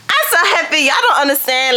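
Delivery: high, giddy happiness; overjoyed gushing. Real, not acted. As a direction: gush high, easing down as you go. Overjoyed about subscribers.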